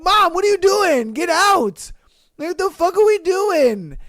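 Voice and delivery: high, loud mock yelling; hammed-up teen yelling at mom; mock shout. Host playing caught teen shouting at mom, high and loud.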